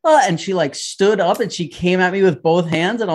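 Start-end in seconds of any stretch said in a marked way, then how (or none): 0.0-0.2 much higher, louder delighted cry; excited mom. Retold or imitated. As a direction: greet him with big delight. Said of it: "she was like".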